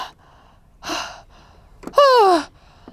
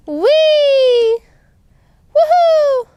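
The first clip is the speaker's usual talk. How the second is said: put-on high, loud whee of a kid on a ride; thrilled playground cheer; gleeful squeal; 10 semitones higher, 6 dB louder, much slower than usual. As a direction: squeal with delight as you swoop.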